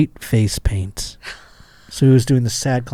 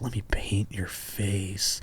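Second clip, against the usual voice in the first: slow hushed creepy whisper; 4 semitones lower, 15 dB quieter, slower than usual. Pretend. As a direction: breathe it slowly like a creep. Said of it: "he's probably saying it all like".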